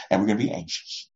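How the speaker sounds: ordinary talk, nothing marked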